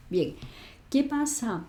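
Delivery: ordinary talk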